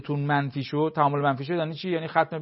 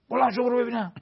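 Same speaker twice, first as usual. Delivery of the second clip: imitating a father yelling, pitched much higher; mock shout; 9 semitones higher than usual. Father losing patience with his son over homework, mock shouting. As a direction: snap into a fed-up shout.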